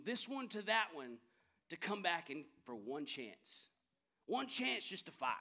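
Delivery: loud, high rallying cry; rally the troops before battle; William Wallace yell. Retold or imitated. Pastor voicing Wallace to the army, a loud rallying yell. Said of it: "he's yelling, and he's shouting".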